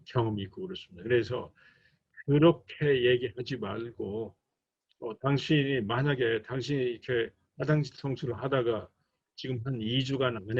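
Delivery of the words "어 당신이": ordinary talk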